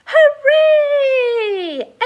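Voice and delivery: loud high hooray; cheer. Acted. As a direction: cheer with joy. Everyone cheers.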